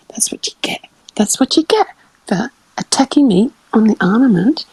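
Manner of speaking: whispered, gleeful that's what you get; a secret whispered told-you-so; gloating whisper. Retold or imitated. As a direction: hiss the payback under your breath. Gloating thought about armament guy.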